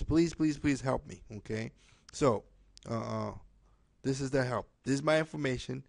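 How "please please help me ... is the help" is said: ordinary talk, nothing marked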